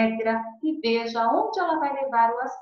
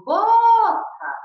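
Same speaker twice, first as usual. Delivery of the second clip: boca with BO stretched high and loud; stress on BO; 12 semitones higher, 11 dB louder than usual. Shown as a demonstration. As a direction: hold and punch the first syllable of boca. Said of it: "a sílaba tônica é a penúltima".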